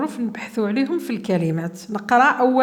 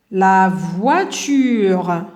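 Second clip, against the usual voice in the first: slow, louder, drawn out; slow model word; 2 semitones lower, 4 dB louder, much slower than usual. Shown as a demonstration. Teacher modelling the word voiture, slow and louder. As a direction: stretch the word for the class to hear. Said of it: "هنا".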